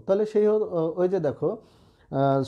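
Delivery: normal talking voice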